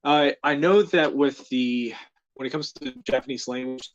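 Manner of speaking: normal talking voice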